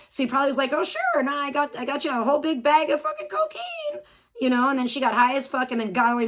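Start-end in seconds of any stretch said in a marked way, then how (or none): 0.7-4.0 higher-pitched, mocking imitation of him; mock drug sharer. Streamer imagining the man offering her cocaine, lightly mocking higher voice. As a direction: playfully imitate an eager offer.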